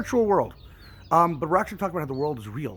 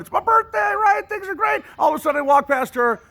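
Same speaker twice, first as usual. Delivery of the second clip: very high, loud, bursting with birthday glee; mock cheerful shout; 9 dB louder than usual. Townsperson bragging about a great birthday, very high and loud. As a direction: shout it like the happiest day ever.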